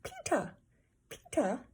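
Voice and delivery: put-on high toddler call. A narrator's reading. Toddler calling his brother from the jungle gym. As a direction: call out like a little kid. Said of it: "he called out".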